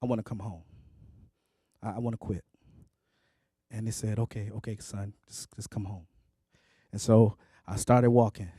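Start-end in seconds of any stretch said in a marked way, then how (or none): 0.0-2.4 low, soft and worn-out retelling; defeated plea. Retold or imitated. Son tells family he wants to quit, defeated, quiet. Retold call home, wanting to quit. 4.2-6.1 low, hushed retold reassurance; gentle reassurance. Parent tells a son to come home, softly reassuring.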